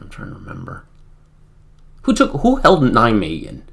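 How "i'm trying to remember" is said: very low, hushed aside; muttering to self. Real, not acted. Streamer searching memory for the unit, muttered to self. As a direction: think aloud under your breath.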